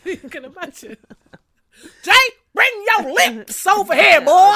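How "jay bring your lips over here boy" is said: loud, high, slow sassy call to [name]; mock [name]. Mocking [name] calling [name], loud high slow shout. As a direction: holler for your man across the house. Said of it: "when she gets home".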